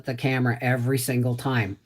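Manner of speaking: ordinary talk, nothing marked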